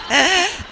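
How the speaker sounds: high, loud burst of laughter; real laugh. Real, not acted. Speaker joking to audience about the room taking off, loud laugh. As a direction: laugh out loud at your own joke.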